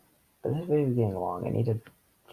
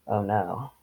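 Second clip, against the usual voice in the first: ordinary delivery, nothing marked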